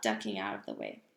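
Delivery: usual speaking voice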